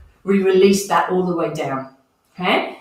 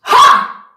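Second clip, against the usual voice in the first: forceful exhale; 9 dB louder than usual. Real, not acted. Breathwork exhale after held breath.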